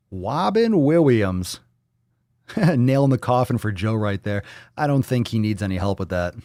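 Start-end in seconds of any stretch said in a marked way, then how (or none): 0.1-1.6 put-on "Robin Williams" in drawn-out baby talk; babyish mispronunciation. Silly mispronounced, stretched name.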